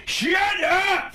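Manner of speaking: high, sudden comic yell; Adam Sandler yell. Acted. Guest shows Sandler's third phase, yelling. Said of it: "he yells and you're just like".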